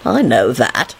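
low, smirking reply; nasty smirk. A narrator's reading. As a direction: answer slyly through a smirk. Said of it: "replied with a nasty smirk".

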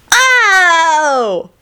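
put-on high child's yelp; wincing little son. Son hearing of the axe, high pained cry. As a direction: yelp like a small boy, drawn out.

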